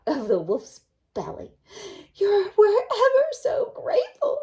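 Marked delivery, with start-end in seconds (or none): 2.1-4.4 put-on high, loud little-girl thanks; grateful girl. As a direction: gush your thanks.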